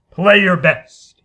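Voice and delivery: slow, loud order; command. Acted. Harrison ordering the musicians, slow and loud. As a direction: demand their very best.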